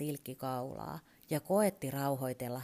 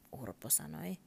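plain reading voice

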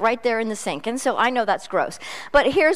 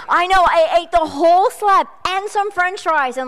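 excited confession; 7 semitones higher, 6 dB louder than usual. Real, not acted. Confessing the rib feast to audience.

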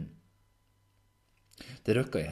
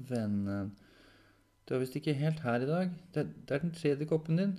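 higher-pitched, concerned caretaker; 8 semitones higher, 4 dB louder than usual. Acted. Caretaker telling Henrik he is not all here, concerned. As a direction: gently point out the confusion, pitched up.

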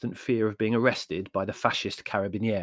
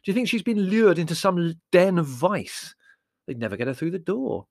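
louder, higher exasperated scoffing; hammed-up incredulous exasperation; 7 semitones higher, 5 dB louder than usual. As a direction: scoff at the worry in rising impatience. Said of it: "said Larry in exasperation".

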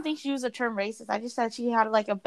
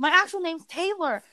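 higher-pitched reply, mildly insistent; retold insistence; 6 semitones higher than usual. Friend answering the man who doubts her name, lightly insistent. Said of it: "And then Taylor's like".

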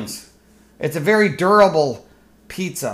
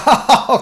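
short forced polite laugh; a hammed-up courtesy chuckle; fake chuckle; 5 semitones higher than usual. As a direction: squeeze out a fake little laugh on cue. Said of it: "give your little fake little chuckle".

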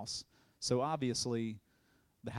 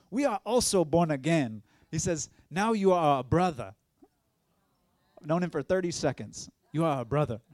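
warm loud welcome; 3 semitones higher, 7 dB louder than usual. Retold or imitated.

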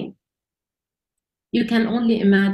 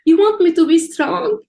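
higher and louder, indignant disbelief; indignant question; 7 semitones higher, 6 dB louder than usual. Retold or imitated. Speaker retorts to God's command, indignant and raised. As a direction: throw the demand back in disbelief.

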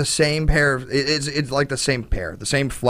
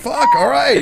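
laughing mock reply; 11 semitones higher, 5 dB louder than usual.

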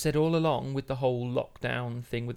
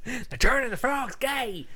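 high, drawn-out comic holler; over-the-top high yell, stretched out; mock ranting shout; 11 semitones higher, 4 dB louder than usual. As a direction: bellow it like an outraged crank. Jokey ranting catchphrase quote.

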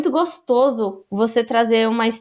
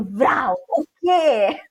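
put-on shocked kid; much slower than usual. Kid's shocked reaction voiced.

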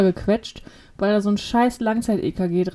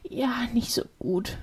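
voiceless whispered reply; whispered answer; 9 dB quieter than usual. Retold or imitated. Nurse's reply retold to the cohost, whispered. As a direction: whisper it like a confession. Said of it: "wie haben die Leute geschlafen?".

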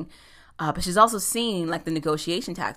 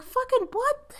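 incredulous; slower than usual. Retold or imitated. Retold incredulous reply to offer.

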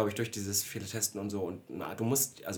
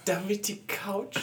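incredulous; 11 semitones higher, slower than usual. Real, not acted. Incredulous echo while laughing.

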